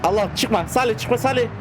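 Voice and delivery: high-pitched plea to the car; urgent high call to stay put. Real, not acted. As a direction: yell after the driver to stop. Yelling at AI driver in game.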